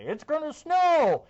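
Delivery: high, loud and slow hype; hyped snow alarm. Retold or imitated. Mocking hype forecasters selling snow panic. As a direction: shout it like a breaking snow alert. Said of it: "do the whole smoke and mirrors thing and say".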